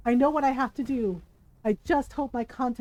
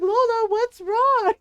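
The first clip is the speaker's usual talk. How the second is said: put-on whiny child; 8 semitones higher, 6 dB louder, slower than usual. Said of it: "my brother was like".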